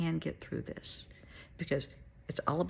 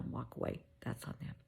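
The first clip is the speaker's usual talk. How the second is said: whispered aside; 5 semitones lower, 12 dB quieter than usual. Real, not acted. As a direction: drop to a whisper. Advice given softly to listener.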